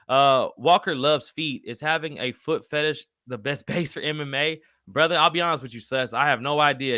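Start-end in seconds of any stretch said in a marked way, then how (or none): none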